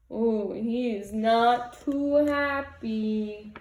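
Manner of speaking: usual speaking voice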